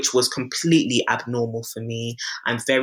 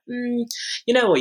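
high musing hum into a raised voice; imitated pondering doctor; 11 semitones higher than usual. GP looking at patient's records, imitated pondering voice. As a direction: mimic a doctor reaching a casual verdict.

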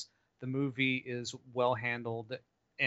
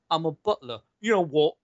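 rough Cockney butler, louder and higher; Michael Caine accent; 4 semitones higher, 9 dB louder than usual. Acted. Co-host mocking Michael Caine cast as Batman's butler, Cockney accent. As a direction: put on a tough working-class Cockney. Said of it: "what the fuck is that accent?".

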